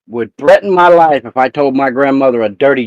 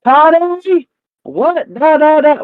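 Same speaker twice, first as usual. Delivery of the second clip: imitating grandma's much higher yell across the room, "Toddy" shouted high; grandma yelling across hall; 14 semitones higher, slower than usual. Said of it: "she would yell across the".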